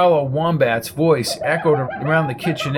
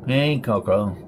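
Winky's lower, raspy greeting; raspy monotone; 4 semitones lower than usual. A narrator's reading. Winky greeting Coco at the door, raspy monotone. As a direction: rasp it out flat, no lift. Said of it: "he said in his raspy monotone".

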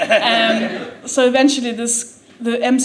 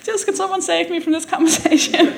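awkward inner voice; 8 semitones higher than usual. Retold or imitated. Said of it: "looks away as he says it".

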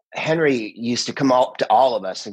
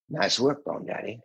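understated film take; 8 dB quieter than usual. Shown as a demonstration. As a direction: toss the line away softly. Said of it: "like that".

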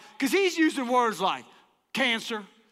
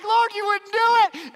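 high, loud mock-desperate plea; panicked plea; 9 semitones higher, 6 dB louder than usual. Janitor pleading with God as the man nears, high mock panic. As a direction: squeak it out in panic.

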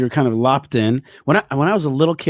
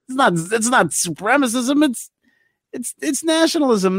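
voiced a bit higher, like a kid defending himself; mock defensive insistence; 8 semitones higher than usual. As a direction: put on a mildly indignant correction. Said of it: "How do they know how to be like".

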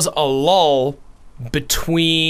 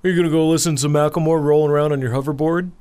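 mocking gym bro. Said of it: "people are like".

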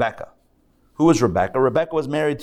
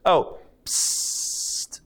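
whispered snake psst to catch your attention; snake hiss; 4 semitones higher, 3 dB quieter than usual. Acted. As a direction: hiss it softly like a sly serpent. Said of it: "that's how a snake would sound".

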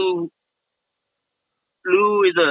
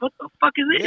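high, quieter incredulous gasp at the price; mock shock; 9 semitones higher, 5 dB quieter, much faster than usual. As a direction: sound stunned by the bill, pitched up. Retelling shock at beer price.

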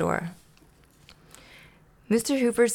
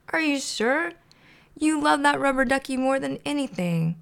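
high disbelieving; 7 semitones higher than usual. A narrator's reading. Hooper disbelieving.

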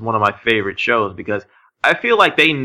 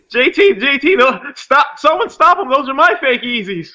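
put-on panicked kid; 13 semitones higher than usual. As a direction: squeal like a kid chasing a thief, half laughing. Mock kid panicking over stolen Yeezys.

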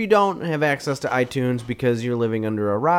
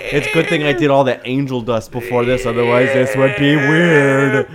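ordinary talk, nothing marked

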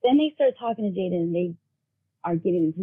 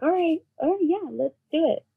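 high-pitched imitation of a willing child; agreeing child; 8 semitones higher than usual. Son agreeing to help his brother, willing. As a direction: voice a child giving in, much higher.

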